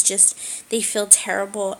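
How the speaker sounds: usual speaking voice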